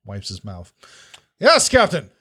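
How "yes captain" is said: high, snappy reply to the captain; higher-pitched dutiful crew voice; eager crewman. Acted. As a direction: answer up like a keen deckhand. Crewman answers the captain's call.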